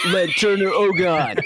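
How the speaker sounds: normal talking voice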